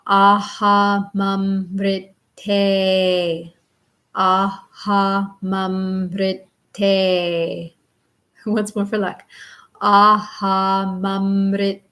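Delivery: Aha mamrite slowly, each syllable clear; slow recitation. Shown as a demonstration. Teacher reciting Aha mamrite for students to repeat. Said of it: "say it a couple times".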